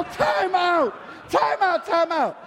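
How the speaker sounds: loud laughing time out; mock shock. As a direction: stop him in loud mock disbelief.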